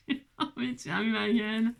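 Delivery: said through a laugh. Real, not acted. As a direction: repeat it while giggling at yourself. Repeats it through own laughter.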